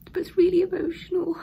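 high, breaking whisper on the verge of tears; real emotion choking the voice; tearful. Real, not acted. Vlogger admitting she's emotional about leaving, tearful whisper. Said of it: "I don't know why I'm emotional".

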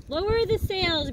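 high, loud order in a storm; captain's shout. Acted. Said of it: "Braid Beard shouted".

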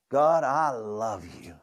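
slow, tender "God, I love you"; slow sincere prayer. Shown as a demonstration. Pastor leading the congregation in prayer, slow and tender. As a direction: lead the room, drawing "God, I love you" out slowly. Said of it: "could you say that with me".

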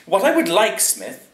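high, loud, brisk lordly order. A narrator's reading. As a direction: instruct the servant briskly. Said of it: "he said, briskly".

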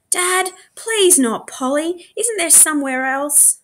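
put-on high, slow pleading child. A narrator's reading. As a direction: beg Dad not to send her. Clancy pleads.

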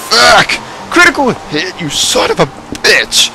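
frustrated curse. Real, not acted. Gamer cursing a critical hit. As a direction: spit it out in frustration.

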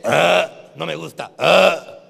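loud disgusted groans around the words; mock disgusted body. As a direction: voice the body hating it. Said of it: "el cuerpo te dice". (el cuerpo, asqueado, en broma)

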